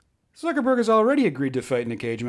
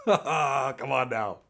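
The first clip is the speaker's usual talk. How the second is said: laughing; 3 dB quieter, much slower than usual. Real, not acted. Laughing at joke.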